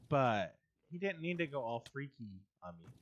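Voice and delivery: ordinary delivery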